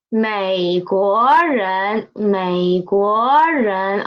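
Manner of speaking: slow modelling. Shown as a demonstration. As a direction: say 美国人 slowly for repeating. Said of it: "It is".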